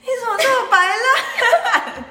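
high, slow, cracking up mid-word; laughing in disbelief. Real, not acted. Friend mocks her advice to the short boy, laughing.

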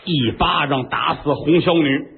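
usual speaking voice

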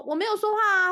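high, loud reply; calling back. Retold or imitated. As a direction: call back across the house. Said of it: "回去说".

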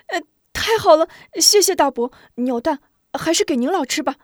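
high, loud, fast thanks; bubbling over with joy; overjoyed boy. Acted. Boy thanks the shopkeeper, high loud joyful thanks. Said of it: "抑制不住惊喜".

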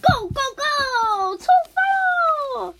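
very high, slow, loud rallying shout; a kid charging off to homework; 兴奋出发. Acted. 绿豆冰冲去写功课. (很高、很响、拖长的兴奋呼喊)

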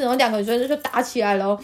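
normal talking voice

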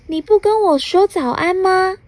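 a bright little sprite teasing a grump; cute fairy voice. Acted. Fairy asks Mr. Rude for a greeting, high and childlike. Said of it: "对傲慢先生说".

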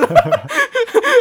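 loud, high squealing laugh; high burst of laughter. Real, not acted. Laughing hard as she recalls him stuck mid-answer.

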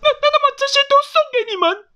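very high, stuttering hen voice; high, loud, quick character voice; flustered, grateful mother hen. Acted. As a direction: gush thanks like an excitable hen. Mother hen speaks in story.

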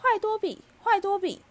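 very high, loud elf shriek; frantic shouting. A narrator's reading. House-elf banging his head on the window, shrieking. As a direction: scold yourself in a wild, repeated cry. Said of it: "嘴里还连连狂吼".

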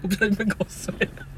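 ordinary talk

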